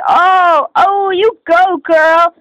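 high, loud, drawn-out whoop; imitated cheer. Classmate cheering the student storming out, loud high whoop. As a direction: crow it out slowly like a cheering classmate.

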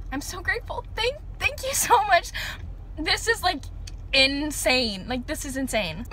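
high, emotional, close to tears; overwhelmed thanks. Real, not acted. Vlogger thanking Bake Crate, near tears with excitement. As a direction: thank them through rising emotion.

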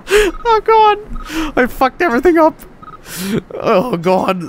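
high squeal through laughter; laughing helplessly. Real, not acted. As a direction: gasp it out while cracking up. Cracking up at the wrecked park.

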